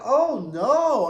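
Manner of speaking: mock horror at the screen; alarmed oh no. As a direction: gasp it like a fight just broke out. Reacting to on-screen fight.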